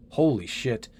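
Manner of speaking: normal talking voice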